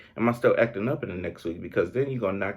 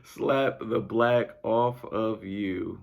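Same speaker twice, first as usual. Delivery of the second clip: slow, drawn-out line squeezed through laughter; slowly stretched words through a laugh; much slower than usual. Real, not acted. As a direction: savour it while still giggling. Repeating the quote laughing.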